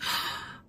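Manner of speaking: mock gasp of awe; imitated amazed gasp. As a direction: gasp like the impressed girl. Retelling girl's amazed reaction.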